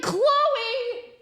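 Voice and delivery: high, drawn-out name call; hammed-up mom outcry; retold exasperated disbelief. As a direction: cry out the name in mock disbelief. Retells exclaiming at daughter.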